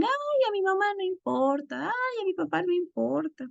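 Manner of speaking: put-on high sing-song kids brushing it off; whiny, carefree child impression; mocking children's voices. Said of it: "Entonces mis hijos dicen".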